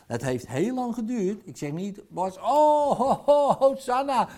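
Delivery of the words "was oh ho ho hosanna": loud, high hallelujah-style outburst; hammed-up cry of jubilation, much louder and higher; mock rejoicing. Mocks a sudden miracle cure.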